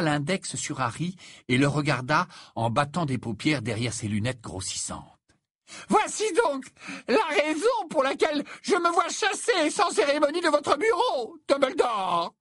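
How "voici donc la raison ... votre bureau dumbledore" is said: much higher, loud, wounded pomp; a dramatic prophetess scorning the headmaster; theatrical seer. A narrator's reading. Trelawney reproaching Dumbledore for her dismissal, high theatrical voice.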